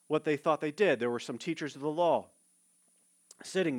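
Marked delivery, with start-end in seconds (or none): none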